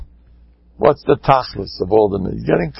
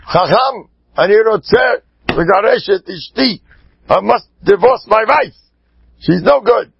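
emphatic, higher-pitched husband's demand; 9 semitones higher, 3 dB louder than usual. Acted. Husband demanding a divorce from the Rav, urgent and insistent. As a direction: announce it urgently in a raised, put-on voice.

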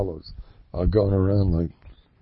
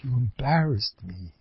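complaining wife; 7 dB quieter than usual. Retold or imitated. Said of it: "So the Mavazani".

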